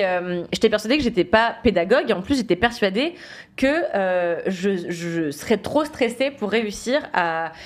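normal talking voice

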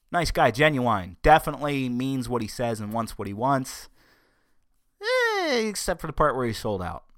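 ordinary delivery

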